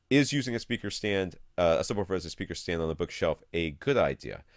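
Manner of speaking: usual speaking voice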